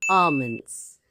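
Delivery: "almonds" as AH-munz, silent l, falling; long "ah" and no l in "almonds"; correct plural. Shown as a demonstration. Teacher showing the plural, silent-l "almonds". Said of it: "and in plural".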